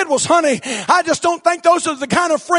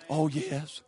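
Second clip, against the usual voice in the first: barely voiced, much softer; quiet pulpit amen; murmured; 10 semitones lower, 15 dB quieter than usual. Real, not acted. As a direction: breathe it to yourself. Soft aside amen.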